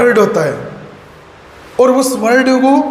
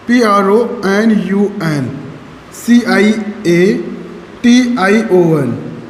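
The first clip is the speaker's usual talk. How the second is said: slow spelling out; 3 semitones lower, much slower than usual. Shown as a demonstration. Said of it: "ये होता है pronunciation".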